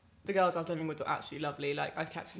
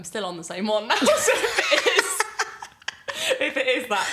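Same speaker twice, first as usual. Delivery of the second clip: loud, high words through laughter; barely getting it out for laughing; helpless real laughter, louder; cracking up; 7 semitones higher, 10 dB louder than usual. Real, not acted. Laughing about the contraception joke.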